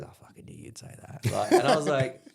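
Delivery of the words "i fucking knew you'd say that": hushed, unvoiced "knew you'd say that" to a mate beside him; whispered aside. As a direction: lean in and breathe it, half amused. Big dog leans over to him.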